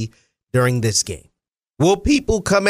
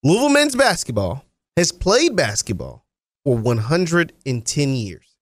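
slow, every word spelled out; over enunciating; 3 semitones lower than usual. Shown as a demonstration. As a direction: drag each word out for the people in the back. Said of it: "let me say that slowly".

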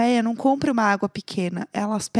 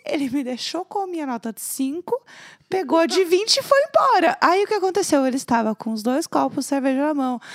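usual speaking voice

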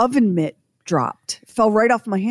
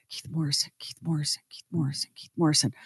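calming mantra; 5 semitones lower, 9 dB quieter, faster than usual. Retold or imitated. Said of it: "say to myself".

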